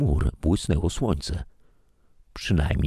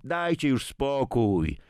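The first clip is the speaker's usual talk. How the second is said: higher and slow at the end, fed up; irritated; 10 semitones higher, slower than usual. A narrator's reading. Daughter tells the men to drop it, irritated. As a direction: snap that she's had enough. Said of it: "zirytowała się Marzena".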